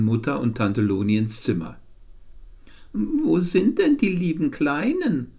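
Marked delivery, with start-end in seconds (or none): 2.9-5.3 put-on high, sugary aunt talking down to kids; aunt's voice for children. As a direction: coo at the little ones like a doting aunt. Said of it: "mit ihrer Stimme für Kinder".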